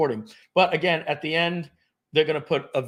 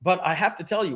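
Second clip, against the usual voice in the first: ordinary talk, nothing marked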